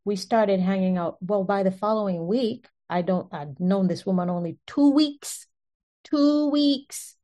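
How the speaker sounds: ordinary talk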